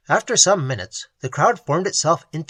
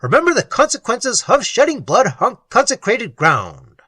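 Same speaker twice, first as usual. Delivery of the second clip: urgent warning; 6 semitones higher, 6 dB louder than usual. A narrator's reading. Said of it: "he exclaimed".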